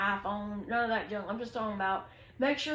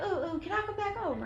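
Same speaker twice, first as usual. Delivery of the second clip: higher and quicker, a hint of a needy guy; mock whiny begging; 8 semitones higher, faster than usual. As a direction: lightly play him wheedling to come over. Orange begging to come back.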